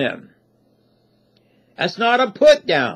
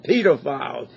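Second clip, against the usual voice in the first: British way of saying it; 6 semitones lower, 5 dB quieter than usual. Retold or imitated. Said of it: "I love the way he says that".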